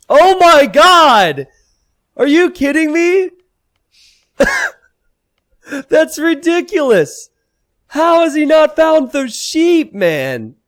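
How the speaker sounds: high, loud incredulous outburst; shocked disbelief. Real, not acted. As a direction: yell it in disbelief. Caster shocked, sheep not found.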